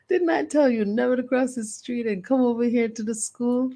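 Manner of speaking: put-on a little girl lecturing grandma again; lightly voiced child scold. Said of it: "And I was like".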